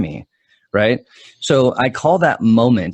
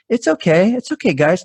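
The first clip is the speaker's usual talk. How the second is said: high, sing-song voice to soothe the dogs; talking to scared dogs, high soothing puppy voice; 9 semitones higher than usual. Retold or imitated. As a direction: coo at the dogs like puppies. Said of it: "in puppy voice was like".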